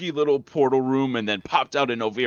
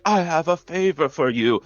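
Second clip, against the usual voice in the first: higher, lightly put-on lady voice; wacky lady; 6 semitones higher than usual. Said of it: "and she was like".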